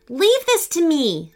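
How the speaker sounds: high, firm resolve; determined. A narrator's reading. Owen stepping up to face the troll, determined. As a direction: take charge, pitch lifted. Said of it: "said Owen with determination".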